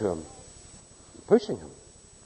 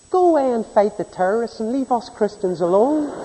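higher, mocking whine of a smug believer; 11 semitones higher than usual. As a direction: parody the self-righteous churchgoer, pitched up. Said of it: "doesn't mean to say".

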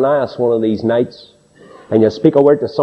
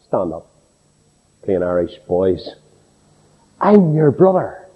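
gentle revelation; 6 semitones lower than usual. Acted. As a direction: lift them up gently. Joseph reveals himself to brothers.

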